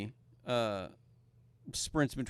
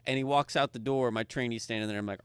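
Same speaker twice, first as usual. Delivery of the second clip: usual speaking voice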